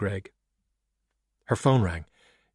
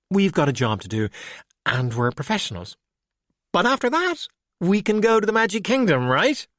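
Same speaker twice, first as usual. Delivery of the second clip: eager plea; 9 semitones higher than usual. Acted. Valkyrie coaxing Skullduggery toward the Magic Kingdom, eager and high. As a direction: pitch jumps much higher on "after that". Valkyrie begging for theme park.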